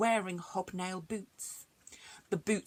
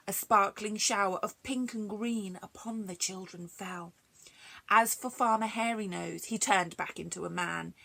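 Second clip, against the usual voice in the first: ordinary delivery, nothing marked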